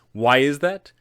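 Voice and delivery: usual speaking voice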